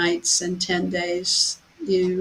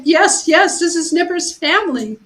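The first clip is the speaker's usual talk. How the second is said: much higher and louder, bursting with joy; excited; 9 semitones higher, 6 dB louder than usual. Retold or imitated. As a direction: blurt it out, thrilled the cat is found. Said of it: "how excited I was".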